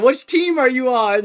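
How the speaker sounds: put-on calling over to a kid on a longboard; retold holler. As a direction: shout it across to the kid. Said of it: "I hollered at him".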